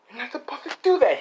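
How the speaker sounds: a villain whining that it's unfair; high whiny complaint. Acted. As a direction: squeak out the protest. Villain complaining after being thrown.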